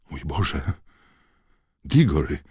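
usual speaking voice

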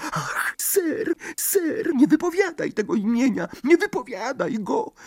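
very high, panicked elf plea; a tiny servant terrified; frightened house-elf. A narrator's reading. Dobby begs in fear. (przerażony skrzat)

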